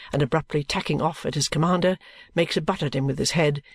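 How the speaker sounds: ordinary delivery, nothing marked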